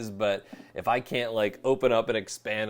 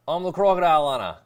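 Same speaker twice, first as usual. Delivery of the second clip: higher, louder mock Australian accent, crocodile stretched out; bad Australian accent; 7 semitones higher, 10 dB louder, faster than usual. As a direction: belt out a hammy Australian cliché. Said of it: "All I know how to say with an Australian accent".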